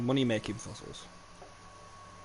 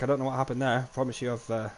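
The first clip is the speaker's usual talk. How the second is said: ordinary talk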